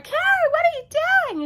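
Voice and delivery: high, loud call over restaurant noise; loud Amelita. Retold or imitated. Amelita calling Carrie from a loud restaurant, shouting high. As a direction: shout it over a noisy room. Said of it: "Amelita's all loud".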